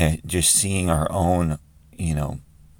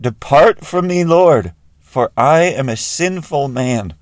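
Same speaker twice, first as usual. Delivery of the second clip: slow, loud, high anguished plea; crying out; 7 semitones higher, 8 dB louder, slower than usual. Acted. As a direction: cry it out slowly, imploring at full voice. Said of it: "he cries out".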